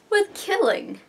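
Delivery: dramatic emphasis. Real, not acted. Dramatic reveal of grace.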